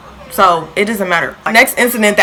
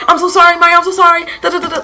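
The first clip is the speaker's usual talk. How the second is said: high pleading apology; apologizing girl; 9 semitones higher, faster than usual. Retold or imitated. Girl who threw food apologizes to Maya, high and pleading. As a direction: imitate her begging to be forgiven. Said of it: "she was like".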